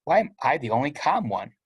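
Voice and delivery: ordinary delivery, nothing marked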